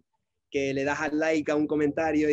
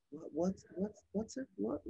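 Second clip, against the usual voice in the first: soft, higher-pitched puzzled echo; baffled waitress; 7 semitones higher, 8 dB quieter than usual. Retold or imitated. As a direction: imitate a confused server repeating the word. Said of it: "she was like".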